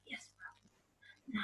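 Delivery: soft unvoiced whisper; meek whispered reply; whispered yes ma'am. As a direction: whisper back obediently.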